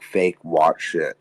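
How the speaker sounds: ordinary talk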